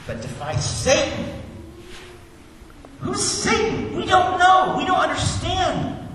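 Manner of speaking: high, loud, rising preacher's voice; full-force preaching. Acted. Pastor preaching to the congregation about Jesus, full force. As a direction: preach it out to the back pew.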